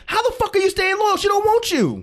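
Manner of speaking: high, heated disbelief; exasperated outburst. Real, not acted. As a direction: throw your hands up at him. Exasperated rant at absent man.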